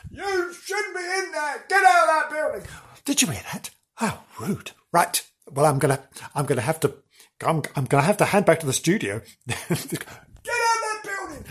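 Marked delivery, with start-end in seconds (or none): 0.1-1.6 high, slow, distant shout; shouting bloke outside. Acted. 1.7-2.7 high distant shout; shouting bloke. Acted. Voicing bloke shouting from outside. 9.5-11.4 high, slow, distant shout; shouting bloke. Acted.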